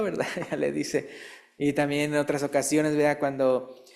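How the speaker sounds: ordinary delivery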